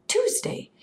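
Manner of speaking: high, hushed gasp of a word; startled, airy whisper; shocked realization. A narrator's reading. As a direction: whisper it in astonishment. Galen shocked to learn the day.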